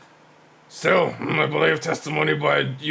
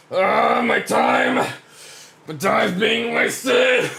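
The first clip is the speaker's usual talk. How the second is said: exasperated judge; 10 semitones higher, 4 dB louder, slower than usual. Acted.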